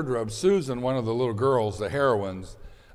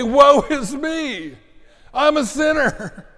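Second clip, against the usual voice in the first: high, slow, loud wail of woe; anguished cry; 12 semitones higher, 8 dB louder, slower than usual. Retold or imitated. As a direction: cry out like someone undone. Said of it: "and say".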